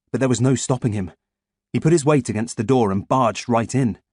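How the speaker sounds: ordinary delivery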